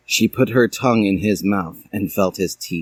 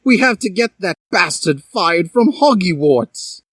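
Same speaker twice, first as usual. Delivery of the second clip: higher, worked-up demand; indignant; 9 semitones higher than usual. A narrator's reading. Draco demanding the teacher be fired, worked-up. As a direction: push for revenge. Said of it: "exclaimed Draco".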